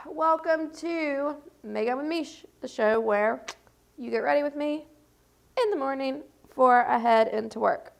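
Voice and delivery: bright host intro voice; show intro. Acted.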